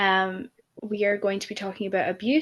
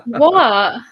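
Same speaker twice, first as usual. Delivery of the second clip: a sudden loud, startled "What?"; 4 semitones higher, 12 dB louder than usual. Real, not acted. Girlfriend hears her partner laugh, snaps a loud startled what. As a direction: caught off guard, loud and high.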